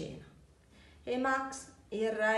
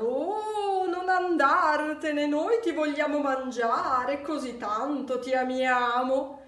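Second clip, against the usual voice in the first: high, loud pleading wail; wild monsters crying out after Max, high and loud; monsters shouting; 6 semitones higher, 7 dB louder, slower than usual. A narrator's reading. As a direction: beg him to stay at full voice. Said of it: "i mostri selvaggi gridarono".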